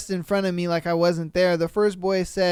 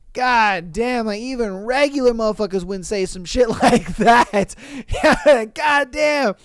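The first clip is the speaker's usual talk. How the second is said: ordinary delivery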